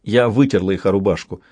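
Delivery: ordinary delivery, nothing marked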